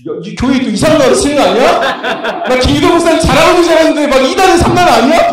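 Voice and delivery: loud, high mock alarm; hammed-up indignant suspicion; suspicious churchgoer. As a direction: play a scandalised listener doubting the pastor. 놀란 청중의 의심을 흉내.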